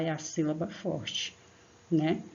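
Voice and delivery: ordinary delivery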